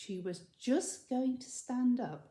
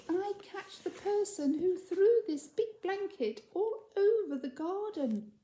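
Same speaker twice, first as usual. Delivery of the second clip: high, birdlike pixie chatter; tiny chirping voice; 8 semitones higher than usual. Acted. Pixie tells the girl about the snow in a tiny chirping voice. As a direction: chirp it like a tiny bird, indignant at the end. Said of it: "in a tiny voice, like a bird chirping".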